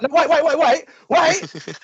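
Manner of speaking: high, loud, rapid-fire waits; urgent pleading. Acted. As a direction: call out in mock panic. Acting a cat owner suddenly stopped.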